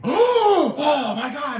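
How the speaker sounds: big high gasp, drawn-out awe; hammed-up gasp of an amazed crowd; mock gasping. Imitating people at the Phantom Menace preview, mock gasping. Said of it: "people were gasping".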